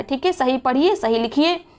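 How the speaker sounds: ordinary talk, nothing marked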